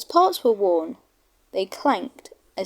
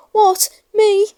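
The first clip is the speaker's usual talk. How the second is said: much higher and louder outraged engine; indignant snort; 12 semitones higher, 7 dB louder than usual. Acted. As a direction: scoff at the very idea. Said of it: "snorted James".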